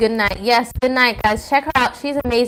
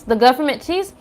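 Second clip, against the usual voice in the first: normal talking voice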